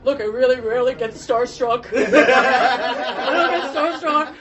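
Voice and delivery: high, loud, laughing through the words; starstruck confession. Retold or imitated. Assistant confessing to the actress he's starstruck, laughing. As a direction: gush it out between laughs.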